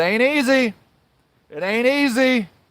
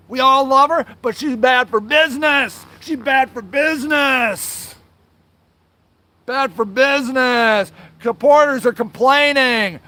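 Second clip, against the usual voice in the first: mocking complaint; 4 semitones higher than usual. Angry ex-volunteer quoting the charity board, mocking complaint.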